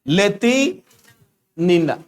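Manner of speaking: लेतिनिना drawn out slowly in two parts, ले-ति, then नि-ना; slow, split name. Shown as a demonstration. Teacher dictating a hard Russian name to students, slowly, in parts. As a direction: say लेतिनिना very slowly, pausing midway.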